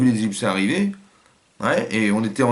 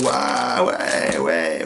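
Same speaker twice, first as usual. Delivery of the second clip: a burst of joy, then the ouais drop much lower; delighted whoop; 3 semitones lower, much slower than usual. Shown as a demonstration. Showing his audience an overjoyed man exclaiming. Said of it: "J'aurais fait comme ça".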